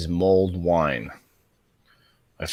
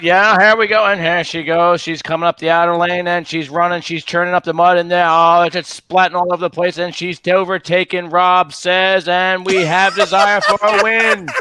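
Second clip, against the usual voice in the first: lively race-caller delivery, slowing to the win; horse race announcer; 10 semitones higher, 7 dB louder than usual. Pretend. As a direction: call the finish like a track announcer. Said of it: "I love the draw you put on the end there".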